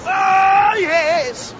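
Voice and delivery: very high excited shout; excited squeal. Acted. Greeter hears the rock star's name, starstruck shout. Greeter reacts to [name].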